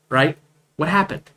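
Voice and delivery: usual speaking voice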